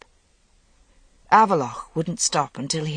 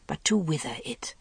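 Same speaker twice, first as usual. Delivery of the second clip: whispered; 7 dB quieter than usual. A narrator's reading. Threat dropped to whisper.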